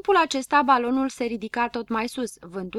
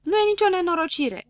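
emphatic retort; 9 semitones higher, 5 dB louder than usual. A narrator's reading. Lentilă contrazice agitația.

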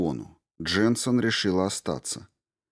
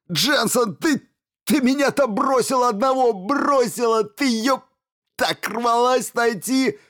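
high, loud accusing wail; anguished angry scream; 5 dB louder than usual. A narrator's reading. Stevens screams at Jensen for abandoning him, high anguished yelling. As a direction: hurl the blame at her, screaming.

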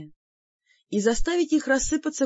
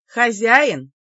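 careless question; 7 dB louder than usual. Acted. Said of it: "небрежно переспросила я".

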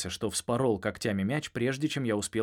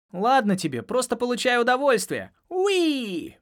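gleeful; 13 semitones higher, 6 dB louder than usual. Acted. Dolphins playing with the ball.

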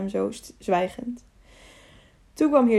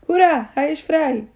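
high, drawn-out slow hurrah; cheering; 6 semitones higher, 5 dB louder than usual. A narrator's reading. Crowd celebrates the freed prisoner, cheering. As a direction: cheer it out louder, stretching the words. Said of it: "barstte los in gejuich".

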